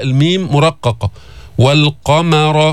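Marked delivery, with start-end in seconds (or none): 1.6-2.7 والقمر with thin م then full emphatic ق; slow والقمر, every letter drawn out; tajweed demo. Shown as a demonstration. Teacher models والقمر for students to practise. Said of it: "بدك تتمرن عليها".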